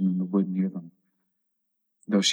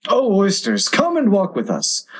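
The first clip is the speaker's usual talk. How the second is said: high-pitched coaxing call, then settling down; pleading invitation; beseeching; 10 semitones higher than usual. A narrator's reading. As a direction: beseech them with a high opening cry. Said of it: "the walrus did beseech".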